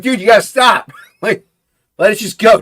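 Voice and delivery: half-laughing shout of dude stop; loud exasperated scolding of a buddy; mock exasperated yell. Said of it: "I'm like".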